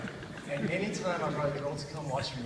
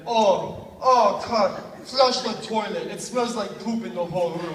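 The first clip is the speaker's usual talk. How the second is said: put-on loud, higher-pitched Bahamian accent; Arispe's thick Bahamian accent; 5 semitones higher, 10 dB louder, slower than usual. Roommate nagging about the toilet, thick Bahamian accent. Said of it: "Bohemian accent".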